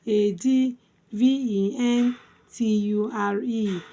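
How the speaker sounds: letters recited in small groups with short breaks; spelled by syllables. Shown as a demonstration. Teacher spelling adventure for learners, syllable by syllable. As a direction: spell it out in chunks for a class. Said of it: "Let's spell it".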